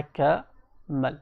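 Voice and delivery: "कमल" broken in two, क, then मल; split into syllables. Shown as a demonstration. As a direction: pause between क and मल in "कमल". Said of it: "आवाज़ें किस तरह से निकल रही हैं".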